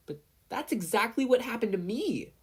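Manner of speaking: put-on high, indignant little boy. Izuku protests to Kacchan, high and indignant. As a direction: protest that it happened to you too. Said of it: "Izuku said indignantly".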